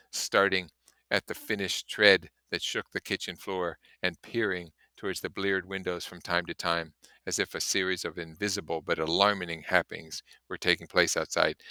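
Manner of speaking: ordinary talk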